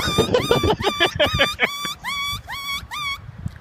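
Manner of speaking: very high squealing laughter; shrieking laugh. Real, not acted. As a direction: lose it completely mid-story. Cracking up mid story.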